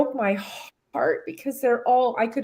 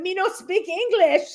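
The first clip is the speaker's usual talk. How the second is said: lightly mimicked broken English, much higher; a playful echo of the broken-English line, higher; mock broken English; 9 semitones higher than usual. Repeating Harry's line while laughing, in light broken English. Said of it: "we laughed about that".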